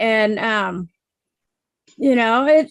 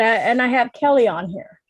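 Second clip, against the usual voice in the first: usual speaking voice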